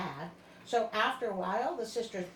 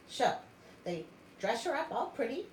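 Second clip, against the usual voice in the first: usual speaking voice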